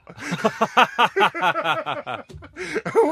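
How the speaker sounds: loud, high laughing fit; big laugh. Real, not acted. Host cracking up at his own prank story, loud laugh. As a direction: crack up at the memory.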